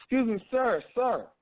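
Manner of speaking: high, loud call for attention. Retold or imitated. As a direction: shout across to get his attention.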